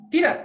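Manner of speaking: sharp, high TIDAK with an abrupt fall; fourth tone TIDAK. Shown as a demonstration. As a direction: bark a short TIDAK as if scolding someone. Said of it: "seperti kita membentak seseorang".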